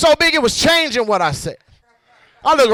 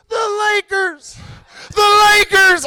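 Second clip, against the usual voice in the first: swelling echo; 11 semitones higher, slower than usual. Acted. Hidden team answers the comic, echo growing nearer. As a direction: bring the high echo closer each time. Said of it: "First pussy echo".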